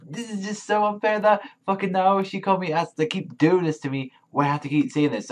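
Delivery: high-pitched, aggrieved complaint; aggrieved protest. Real, not acted. Fan complaining that the author keeps doing this, aggrieved.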